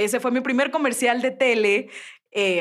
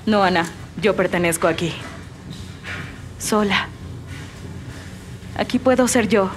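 resigned, choosing solitude; Elsa dubbing; 4 semitones lower than usual. Acted. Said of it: "vas a ser Elsa".

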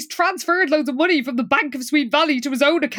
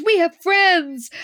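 higher, drawn-out mock delight; happy; 7 semitones higher, slower than usual. Liz sees the party guests, happy retold exclamation. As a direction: imitate a delighted girl, slowly savouring each word. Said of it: "Liz happily says".

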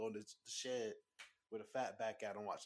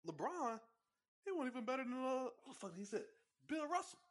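high squeaky mock voice of the boss; 12 semitones higher than usual. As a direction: imitate a clueless boss, pitched way up. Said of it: "he's like".

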